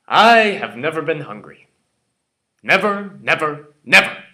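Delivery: loud, high, hammering each repeat; indignant retort. Acted. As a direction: throw the accusation back forcefully, word by word. Stung reply to party's accusation.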